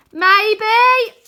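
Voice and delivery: high, loud unsure shout; doubtful yell. Acted. Arthur doubtful while yelling.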